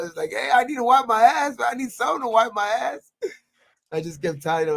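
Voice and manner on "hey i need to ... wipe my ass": much higher, laughing through the words; cracking up while mocking the vendors; laughing taunt. Teasing towel vendors about toilet paper, laughing. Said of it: "I was just laughing".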